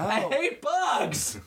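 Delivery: high, slow drawn-out whine; high mock voice. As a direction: stretch it out squeaky.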